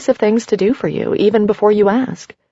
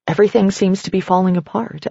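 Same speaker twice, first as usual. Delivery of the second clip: ordinary delivery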